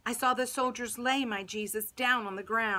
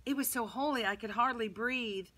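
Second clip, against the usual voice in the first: plain reading voice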